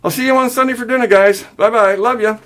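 high, quick, loud farewell; calling goodbye. A narrator's reading. Son leaves his parents after the row, calling out. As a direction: call back brightly from the door.

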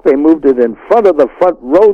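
normal talking voice

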